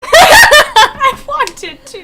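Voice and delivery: high, loud, drawn-out mock wail; mock wailing regret. After running from the baby, mock wail. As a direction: lament it slowly through laughter.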